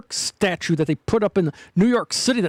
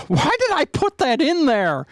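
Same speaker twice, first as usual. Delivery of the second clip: puzzled; 8 semitones higher than usual. Real, not acted. Surprised at own clip.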